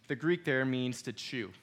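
usual speaking voice

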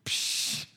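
scoffing puff of air; dismissive psh. Retold or imitated. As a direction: brush it off with a hissed psh. Dad dismisses the tornado warning.

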